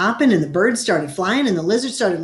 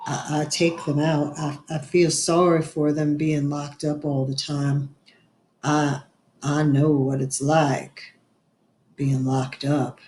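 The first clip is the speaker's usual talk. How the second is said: lightly voiced shy man, lower and slow; shy Otis; 5 semitones lower, slower than usual. A narrator's reading. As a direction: mumble it like a bashful man.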